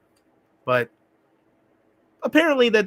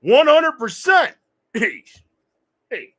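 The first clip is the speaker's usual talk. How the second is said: loud, much higher, slow Rocky shout; Rocky impression; 5 semitones higher, 6 dB louder, much slower than usual. Streamer performs his Rocky impression for chat, loud. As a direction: belt it like Rocky. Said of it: "that was pretty good".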